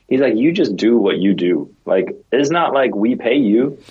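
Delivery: normal talking voice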